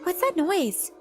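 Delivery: high, frightened question; a girl startled by spooky noises; scared. A narrator's reading. Girl hearing ghost noises, asks scared and high. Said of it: "in a scared voice".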